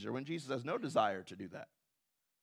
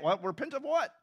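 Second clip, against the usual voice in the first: mock disbelief; 9 semitones higher than usual. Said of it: "Jesus is telling me I need to repent".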